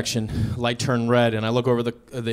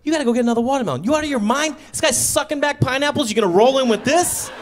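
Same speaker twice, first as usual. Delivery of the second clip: fast frantic scolding; 9 semitones higher, 3 dB louder than usual. Retold or imitated. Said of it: "And I go".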